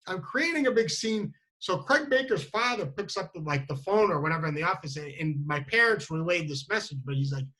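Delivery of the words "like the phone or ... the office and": normal talking voice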